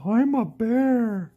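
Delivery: loud, high, slow toy-bear voice; Diva. Pretend. Toy bear introduces herself to everyone, funny high voice. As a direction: belt it out high, stretching each word. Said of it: "then just go".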